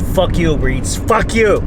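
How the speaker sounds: loud, high, furious cursing; real angry outburst at a company; pissed off. Real, not acted. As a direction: hurl the insult, last words pitched highest. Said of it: "Pissed me off".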